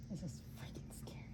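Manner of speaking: whisper. Real, not acted. Scared aside while bird approaches.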